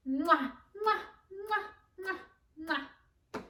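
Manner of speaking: smacking movie-star air kisses; kissing sounds. Shown as a demonstration. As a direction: blow big dramatic kisses. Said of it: "How do you think they kiss?".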